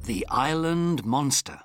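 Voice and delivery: ordinary delivery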